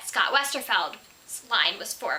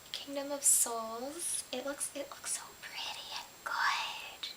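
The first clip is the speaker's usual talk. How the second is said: whispering; 4 semitones higher, 10 dB quieter, slower than usual. Real, not acted. Gushing over a pretty book.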